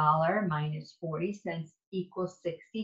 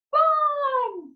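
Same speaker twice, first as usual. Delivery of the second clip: very high, loud squeal; a loud high burst of delight; gleeful cheer; 5 dB louder than usual. Acted. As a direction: shriek it with joy. Excited cheer in story.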